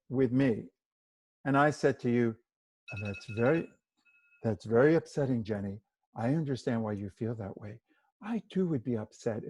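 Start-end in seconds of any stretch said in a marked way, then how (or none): 2.9-9.4 subdued, detached acknowledgement of feelings; unmoved formal sympathy. Shown as a demonstration. To an upset friend on the phone, detached sympathy. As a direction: say sympathetic words with no feeling in them. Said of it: "it's the way you say the words".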